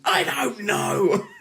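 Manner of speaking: high, slow, laughing out the line; a kid's sheepish admission, giggling; bursting into laughter at the punchline. Retold or imitated. Child admits not knowing, laughs.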